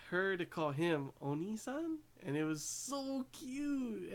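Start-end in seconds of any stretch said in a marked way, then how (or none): 2.2-4.1 high and slow, squealing over something adorable; gushing. Real, not acted. Fan gushing to viewers over a cute anime moment, high, slow squeal. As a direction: let the word cute melt upward into a swoon.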